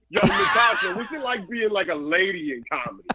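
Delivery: imagined caller. Pretend. Comic pretends to be a caller asking the host, teasing. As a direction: pretend to be a cheeky phone-in caller. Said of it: "What if the caller was like".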